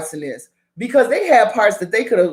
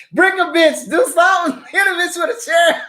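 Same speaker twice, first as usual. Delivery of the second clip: excited joking; 8 semitones higher than usual. Real, not acted. Joking fight advice.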